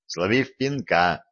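ordinary delivery, nothing marked

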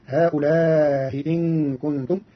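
هؤلاء with the first hamza softened and the lam drawn long, slow; eased first hamza. Shown as a demonstration. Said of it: "بتسهيل الهمزة الأولى".

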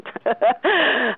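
loud high burst of laughter; high loud laugh that falls away; bright laugh. Acted. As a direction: laugh at your own teasing. Friend laughing at her teasing.

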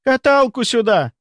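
high, army-sergeant order; commanding shout. A narrator's reading. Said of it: "скомандовала старшая медсестра".

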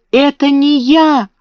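high, slow, tearful denial; tearful insistence. A narrator's reading. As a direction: swear it through tears. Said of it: "со слезами уверяла Бекки". (со слезами, высоко и медленно)

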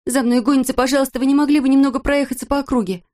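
high, breathless begging; frantic pleading. Acted. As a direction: beg him to drive off. Molly begging, panicked.

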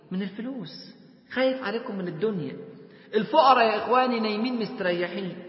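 ordinary talk, nothing marked

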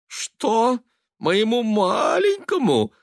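high-pitched mock-shocked mother; joking. A narrator's reading. Mother teasing her son about drink, joking. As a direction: coo it high in playful outrage. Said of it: "шутливо сказала Элиза".

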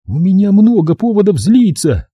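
high, indignant retort; high-pitched indignation; angry captive woman. A narrator's reading. Woman angrily defends her anger.

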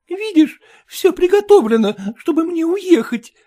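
high, loud, tearful wife; young wife. Acted. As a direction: complain like an upset young bride. Wife's voice.

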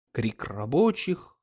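ordinary delivery, nothing marked

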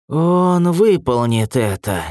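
slow serpent hiss; hissing snake voice. A narrator's reading. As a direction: draw it out like a snake. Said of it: "прошипела змея".

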